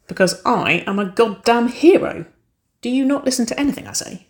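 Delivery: mock-boastful, with teasing emphasis; playful voice. A narrator's reading. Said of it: "her voice playful".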